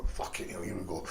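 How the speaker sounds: low, soft resigned mutter; muttered to self. Retold or imitated. Bracing for a fight, muttering to himself. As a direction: grumble it under your breath, bracing for trouble. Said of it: "I'm going".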